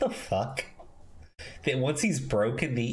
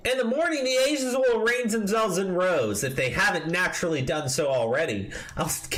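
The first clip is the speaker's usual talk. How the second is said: high, raised reading voice; mock pompous reading; 7 semitones higher than usual. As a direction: read it like a smug manifesto. Reads article quotes in a put-on voice.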